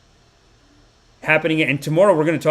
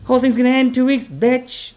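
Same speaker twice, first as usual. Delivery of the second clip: mock quitting rant; 9 semitones higher than usual. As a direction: fling it at the boss, high and quick. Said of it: "Go to work and just be like".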